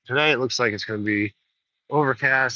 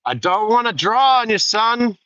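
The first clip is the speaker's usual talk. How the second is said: high, loud drawling cowboy warning; Jude Law's gunslinger; 9 semitones higher, 5 dB louder than usual. Retold or imitated. Gunslinger warning the young albino rider, high and loud. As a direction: warn the kid off like an old gunfighter. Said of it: "Jude Law says to him".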